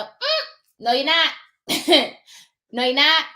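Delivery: high, loud flat no; sassy refusal. Real, not acted. Notary refusing a realtor who wants the docs, sassy. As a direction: shut the realtor down.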